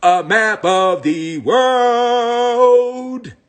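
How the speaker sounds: normal talking voice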